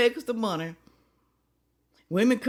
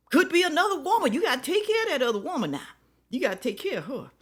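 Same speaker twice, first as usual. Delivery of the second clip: mocking; 8 semitones higher, 3 dB louder, faster than usual. Real, not acted. Mocking the husband's excuse.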